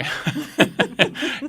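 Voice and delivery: breathy high laugh after a joke; short amused laugh. Real, not acted. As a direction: laugh it off lightly. Host laughing at own joke.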